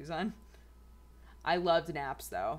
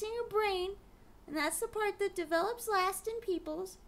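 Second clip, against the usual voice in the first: put-on much higher, squeaky baby voice talking to the cat; high cutesy pet voice. Vlogger voicing her cat, explaining tantrums in a high squeaky voice. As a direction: speak for the pet in a tiny high voice. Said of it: "I'll stop talking like that".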